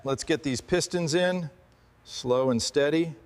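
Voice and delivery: normal talking voice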